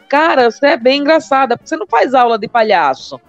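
usual speaking voice

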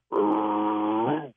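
rolling, drawn-out creature growl. Shown as a demonstration. Showing the cohost the growl they use, imitated growl. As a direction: imitate their long rumbling greeting growl. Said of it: "there's a growl they use".

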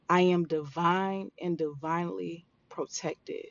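calm, deliberate affirmations to repeat; guided affirmations. Acted. Leads listeners in affirmations.

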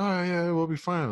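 high, mock carefree voice; mocking careless guys. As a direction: toss it off like a careless frat guy. Guys brushing off his worry.

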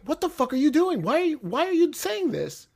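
high-pitched exasperated disbelief, acted out; incredulous protest. Voices Tony Khan's imagined exasperated reaction.

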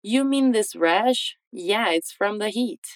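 slow, rising question then falling answer; slow model dialogue. Shown as a demonstration. Teacher reads James's full reply slowly for students. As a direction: read the whole reply slowly for learners. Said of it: "Repete depois de mim".

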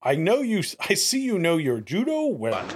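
playfully imitated orator; Charles Doja impression. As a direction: draw out the opening in mock admiration. Mimicking the clip's theatrical compliment.

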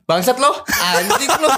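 high, slow mock insult; mock angry boyfriend. Playing the ex-boyfriend cursing at Lala, high slow mock snarl. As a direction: drag out the curse like a sneering ex. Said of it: "gue cowoknya nih".

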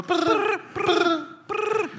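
high buzzing brrr sounds; cicada buzz. Shown as a demonstration. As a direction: buzz the jingle like an insect. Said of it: "you sound like a cicada".

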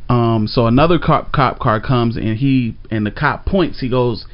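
usual speaking voice

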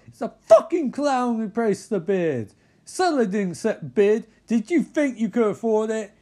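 high, loud outraged tirade; exaggerated shouting indignation; acted rant. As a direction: rant in hammed-up fury. Acted ranting in recap.

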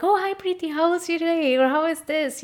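mock cheerful greeting. Retold delegates greeting her.

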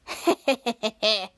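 low evil gloating chuckle; villain chuckling at the trapped hero. Acted. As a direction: laugh low like a cartoon villain. Villain gloating over sweat.

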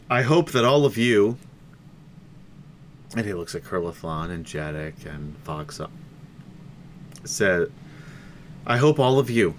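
speaking through hiccups; a hiccup breaks the speech. Real, not acted. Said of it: "I'm fucking hiccuping still".

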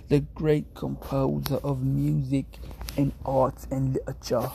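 slow, lightly posh English accent, stressing great. Shown as a demonstration. Streamer approving his own posh English delivery. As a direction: boast it like a grand English maestro. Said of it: "Yes, yeah, like that".

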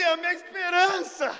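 high, loud cry of lost hope; dramatic outcry. Retold or imitated. Seeker mourning his lost hope, crying out. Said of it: "de tanto que eu gritei ali".

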